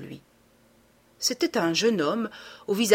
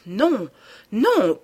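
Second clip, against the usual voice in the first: épouvante; 3 semitones higher, 6 dB louder than usual. A narrator's reading. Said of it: "avec une sorte d'épouvante".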